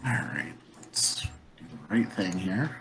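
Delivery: breathy whisper under his breath. Real, not acted. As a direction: whisper it privately. GM whispering aside to himself.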